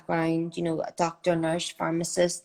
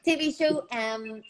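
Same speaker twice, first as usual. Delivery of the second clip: normal talking voice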